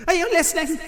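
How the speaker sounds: high-pitched, menacing question; stern demand. Acted. Headteacher challenging the pupil she condemns, stern demand. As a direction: demand attention, holding back the full shout.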